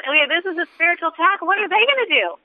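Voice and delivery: high-pitched, quick, dismissive disbelief; incredulous retort. Retold or imitated. Arguing back at the enemy's suggestion, high incredulous retort. Retelling her scornful thought back.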